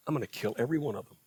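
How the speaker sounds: quick, low, dropped repeat of the threat; rushed repeat. Retold or imitated. God repeats the threat to Moses, fast and low.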